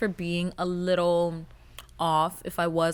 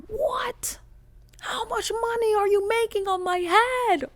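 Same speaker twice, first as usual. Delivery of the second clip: outraged shriek; 14 semitones higher, slower than usual. Acted. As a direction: scream it at the ceiling. Outraged at mandatory fee.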